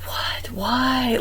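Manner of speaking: soft, breathless whisper of confusion; confused whisper. Retold or imitated. Puzzled over her spirit animal, a bewildered whisper. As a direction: whisper it in disbelief.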